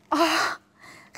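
high enraptured gasp. A narrator's reading. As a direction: let out an awed "oh". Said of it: "rief sie hingerissen".

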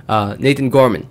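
ordinary delivery

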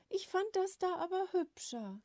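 put-on high, light little girl's voice; a cheeky girl defending her drawing. A narrator's reading. Bixi, the daughter, voiced. (hohe, freche Mädchenstimme)

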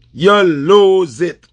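slow mocking repeat to a rival; slow emphatic echo. Real, not acted. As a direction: drag out each word to rub it in.

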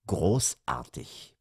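low, slow, soft grumble; grumbling. A narrator's reading. Father grudging the wish for a fire, grumbling. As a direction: grumble it sourly under the breath. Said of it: "brummte der Vater".

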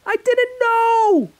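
high, loud and slow wail; boy crying out his innocence; anguished cry. Acted. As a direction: drag the words out in despair. Alex pleading shout.

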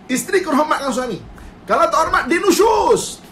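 hammed-up husband laying down the law; mock indignant husband. Preacher voices a husband scolding a disobedient wife, mock angry. Husband's rule restated angrily.

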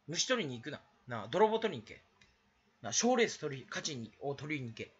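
brisk, pushy tsukkomi in fake Kansai dialect. YouTuber jabbing at a streamer's clips in fake Kansai dialect. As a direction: roast each clip like a Kansai comic, in put-on Kansai dialect. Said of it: "エセ関西弁でやってしまって".

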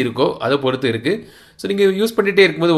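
muffled behind respirator; speaking through mask valve; through a face mask. Real, not acted.

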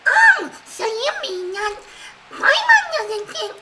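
very high, squeaky mascot voice; Paimon impression. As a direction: squeal it in a mocking falsetto. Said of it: "and then Paimon has to reiterate everything".

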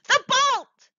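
high, loud urgent shout; strained cry. A narrator's reading. Wife at the door calling for help, strained shout. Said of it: "the old woman's voice, strained and panting".

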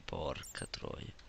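slow breathy whispered swear; whispered curse. Real, not acted. Curse after failed dig.

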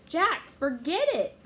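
high, loud, drawn-out plea; exasperated long shout; urgent yell. A narrator's reading. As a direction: stretch it out as you yell after him. Annie yelling to Jack.

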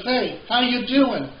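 high, slow imitation Brooklyn accent. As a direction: draw out a mock Brooklyn come-on. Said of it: "Wasn't one of my best Brooklyn accents".

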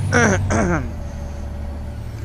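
rough throat clear. Real, not acted. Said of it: "I'm losing my voice".

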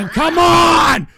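high, loud, drawn-out no come on; exasperated shout. Real, not acted. Host yelling at the troll donation, exasperated shout. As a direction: yell it in exasperation. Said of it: "exasperated at troll TTS".

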